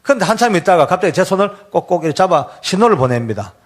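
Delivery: usual speaking voice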